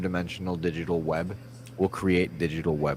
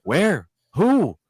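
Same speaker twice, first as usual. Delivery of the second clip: surprised; 8 semitones higher, 6 dB louder than usual. Retold or imitated. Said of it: "I'm like".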